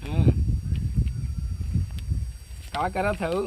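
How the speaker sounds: normal talking voice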